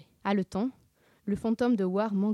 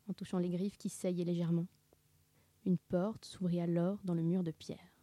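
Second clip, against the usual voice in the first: plain reading voice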